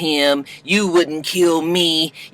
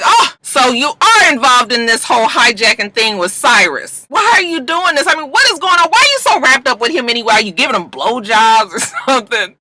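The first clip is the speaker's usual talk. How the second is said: nosy wife accusing; 7 semitones higher, 3 dB louder than usual. Retold or imitated. Nosy wife confronts husband.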